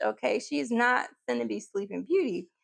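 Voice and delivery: ordinary talk, nothing marked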